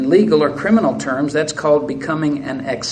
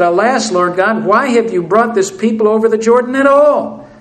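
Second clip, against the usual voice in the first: complaining Joshua; 5 semitones higher, 5 dB louder than usual. Acted. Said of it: "Sounds like the complaining Israelites".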